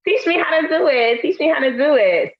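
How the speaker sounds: imitating her younger self, high-pitched; trying to be cute. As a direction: play cute to impress a crush. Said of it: "was like".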